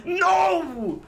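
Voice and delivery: high, drawn-out melodramatic wail; over-the-top tragic howl; mock anguished cry. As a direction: cry out in hammed-up grief. Acted cry over family's death.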